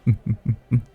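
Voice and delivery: short amused chuckle to a lover; seductive chuckle. Acted. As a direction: let out a teasing little laugh. Amused lover nuzzling partner.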